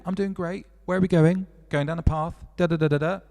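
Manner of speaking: cartoon character reply. Spider answering bird on the path, high and playful. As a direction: bounce it up high like a tiny creature. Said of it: "And then they go".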